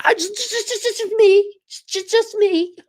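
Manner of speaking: high silly stutter; squeaky mock stammer. Self-mocking squeaky stammer.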